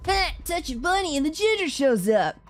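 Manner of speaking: high, loud mocking sneer; sneering bully. Bran telling the newcomer to get lost, sneering. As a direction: drip fake politeness on kindly.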